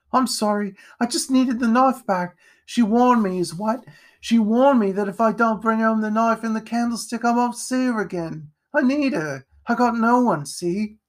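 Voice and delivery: put-on high, agitated, desperate boy; distraught pleading. A narrator's reading. Jack explains himself to wounded Edgar, distraught.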